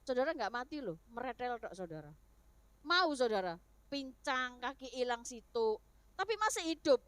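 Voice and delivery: ordinary talk, nothing marked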